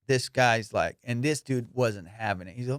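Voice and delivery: ordinary talk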